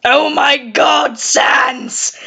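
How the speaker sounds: slow, drawn-out exasperated cartoon call; exasperated Papyrus. Papyrus calling out Sans, slow exasperation. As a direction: stretch out each word of the scolding.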